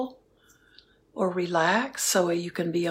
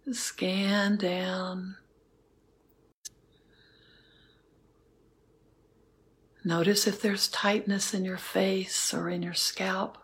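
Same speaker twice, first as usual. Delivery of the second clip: guided meditation. Acted. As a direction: guide them gently into stillness. Leads a metta meditation.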